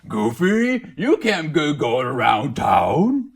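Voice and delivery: slow, high villain cartoon voice; Pete voice. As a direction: growl it like a cartoon bully. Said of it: "the villain guy".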